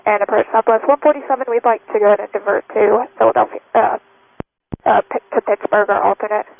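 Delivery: thin radio transmission from the cockpit; pilot cockpit headset. Real, not acted. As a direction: headset mic over the radio. Pilot radios divert request.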